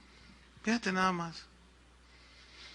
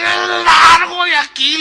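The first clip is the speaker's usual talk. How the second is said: furious yell, much higher and louder, slow; angry shout; 11 semitones higher, 15 dB louder, much slower than usual. Retold or imitated. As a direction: bark the order to get out. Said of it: "Y hasta enojado le dice". (grito furioso, lento y mucho más agudo)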